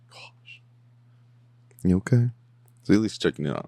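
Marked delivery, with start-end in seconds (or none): 0.1-0.6 whispered gosh. Real, not acted. Tense moment while watching.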